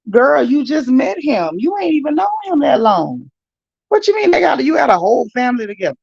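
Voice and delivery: exasperated disbelief. Real, not acted. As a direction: call her out like she's in the room. Scolding absent Tamar in disbelief.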